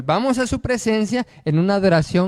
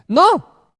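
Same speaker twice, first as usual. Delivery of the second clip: emphatic no; 10 semitones higher, 8 dB louder than usual. Real, not acted. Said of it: "emphatic denial".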